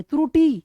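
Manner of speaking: त्रुटि with a strong ट and a short, weak final इ; त्रुटि, ट prominent, इ short; stressed ट. Shown as a demonstration. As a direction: punch the ट in त्रुटि and clip the इ. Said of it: "ट की तो उभर रही है और ई की दब रही है".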